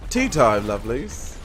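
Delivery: playful fake English accent; Tracer. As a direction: call it out in mock English. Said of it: "I'm adorably English".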